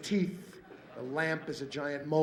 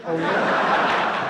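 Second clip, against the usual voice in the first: much louder laughing "Oh, yeah?"; loud challenge; 8 dB louder than usual. Retold or imitated. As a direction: throw it back loud, mockingly. Third person in pain argument.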